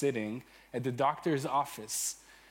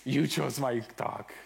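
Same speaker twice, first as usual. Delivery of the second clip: ordinary talk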